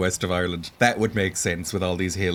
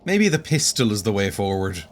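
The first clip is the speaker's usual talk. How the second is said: usual speaking voice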